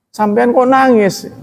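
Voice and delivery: high, slow, drawn-out mock disbelief; incredulous question. Teacher voicing onlookers doubting a crying commander, mock disbelief. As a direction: ask it as if you can't believe a commander cries.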